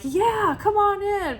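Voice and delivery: high, loud, overly bright "come on in"; hammed-up cheery invitation; mock cheerful welcome. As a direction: fake enthusiasm, sing it out. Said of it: "capable of being like".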